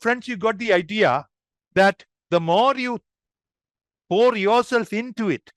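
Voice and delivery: ordinary talk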